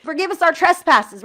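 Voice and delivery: earnest emphatic plea; with emphasis. Shown as a demonstration. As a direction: push the line out with feeling. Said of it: "saying these words with emphasis".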